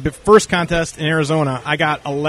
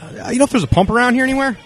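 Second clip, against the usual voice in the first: cheerful ask; 9 semitones higher than usual. Retold or imitated. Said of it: "He said".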